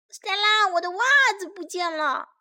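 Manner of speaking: high-pitched, quick call to sister; child calling out. A narrator's reading. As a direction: call out high like a dismayed little kid. Child calls sister over lost sock.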